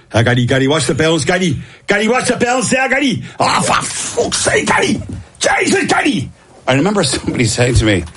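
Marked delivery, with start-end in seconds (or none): 0.1-3.2 Dublin soccer coach calling, climbing higher each time. Retold or imitated. Soccer coach nagging a young player from the touchline, rising pitch. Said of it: "soccer guys are more". 3.4-6.3 high exasperated shout, mock coach; soccer coach losing it. Soccer coach screaming at a slow player, high mock shout. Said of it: "soccer guys are more".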